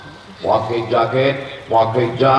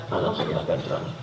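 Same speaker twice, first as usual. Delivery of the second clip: whisper; 10 semitones lower, 9 dB quieter, faster than usual. Retold or imitated. Mimicking a voice on YouTube.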